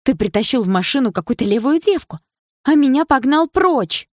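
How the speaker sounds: high, loud jealous accusation; outraged, high-pitched outburst; indignant exclamation. A narrator's reading. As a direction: fling the accusation at him loudly. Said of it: "воскликнула Карина".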